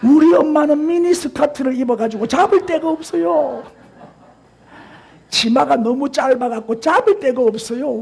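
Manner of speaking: a hint of a whiny young child; lost child. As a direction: play a small child pleading. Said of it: "그 애가 뭐라고 말한 줄 알아요".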